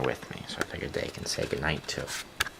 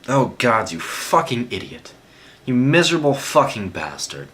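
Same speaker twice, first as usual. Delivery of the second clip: loud, high cursing in grief; furious anguished shout; 7 semitones higher, 9 dB louder than usual. A narrator's reading. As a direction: yell at your bleeding friend through tears. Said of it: "Jean cried".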